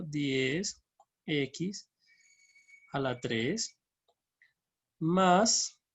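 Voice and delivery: word by word, long pauses between; halting, one term at a time; piecemeal dictation. Real, not acted. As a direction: dictate each term as you type it. Dictates formula while typing it.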